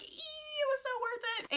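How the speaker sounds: very high-pitched giddy squeal; re-enacted squeal of delight; fangirl squeal. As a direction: squeak it out like a thrilled fan. Fangirl squeal after meeting idol.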